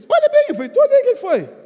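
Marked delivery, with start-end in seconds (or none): 0.1-0.5 high, loud, urgent squeal of insistence; insistent wife. Retold or imitated. Wife urging husband to look at a man's eyebrow, insistent. 0.7-1.5 high-pitched retold protest; exasperated husband. Husband answering his insistent wife, exasperated.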